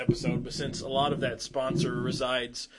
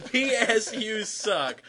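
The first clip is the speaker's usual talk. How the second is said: laughing; 7 semitones higher, 4 dB louder, much slower than usual. Real, not acted. Repeats the insult, laughing.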